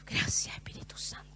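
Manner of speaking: soft unvoiced whisper of thanks; hushed, reverent whisper to God. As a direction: breathe a grateful prayer under the breath. Grateful prayer in story.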